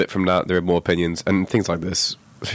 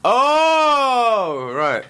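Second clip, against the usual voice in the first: high, loud surprised oh; sudden realisation; 3 dB louder than usual. Real, not acted. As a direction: gasp as it clicks.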